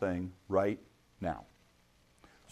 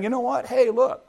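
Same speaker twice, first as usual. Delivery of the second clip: a high, friendly pitch like a lender luring a borrower; lightly voiced slick moneylender, pitched up; smooth lender; 8 semitones higher than usual. Lenders offering a loan.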